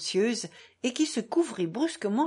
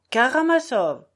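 louder, higher repeated name; raised, exasperated insistence; insistent correction; 6 semitones higher, 8 dB louder than usual. A narrator's reading. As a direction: hammer the correct name home.

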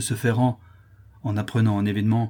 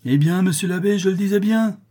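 high, loud, rising denunciation; shrieking show of indignation; accusing exclamation; 10 semitones higher, 5 dB louder than usual. Acted. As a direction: cry out as if vindicated. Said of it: "il s'écria en me montrant".

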